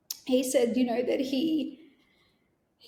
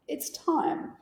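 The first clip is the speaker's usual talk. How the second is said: soft whisper; 4 semitones higher, 5 dB quieter than usual. Retold or imitated. Granddaughter echoing the nurse's gentle words, whispered. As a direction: breathe the words out unvoiced.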